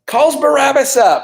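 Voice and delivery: emphatic teacher's echo, stretched out; loud confirmation. Real, not acted. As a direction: boom the answer back slowly to the class. Loudly confirms a student's answer.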